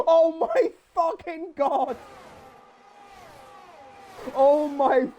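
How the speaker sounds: slow, high cry of disbelief; reacting to a car retiring; stunned shout. Real, not acted. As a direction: drag it out in shock.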